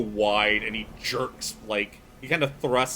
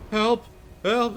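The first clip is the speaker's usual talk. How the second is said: high-pitched, panicked cry for help; acted desperate pleading; breathless plea; 13 semitones higher, 4 dB louder than usual. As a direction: gasp for help as a winded, frightened boy. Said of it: "like, out of breath".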